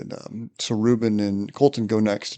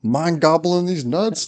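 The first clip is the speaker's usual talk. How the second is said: much higher, louder triumphant taunt; gleeful punchline; 7 semitones higher, 4 dB louder, slower than usual. Retold or imitated. Reagan springs the joke on the new guy, high and loud. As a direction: slam the punchline home. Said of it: "And he's like".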